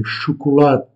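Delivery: "chocolat" ending in a light E sound, sho-ko-LA-teh; Portuguese pronunciation. Shown as a demonstration. Teacher to French learners, "chocolat" with a faint final E. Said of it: "Le E à la fin est légèrement prononcé".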